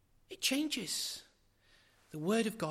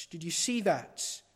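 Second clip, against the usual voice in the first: normal talking voice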